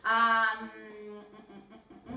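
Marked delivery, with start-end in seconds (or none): none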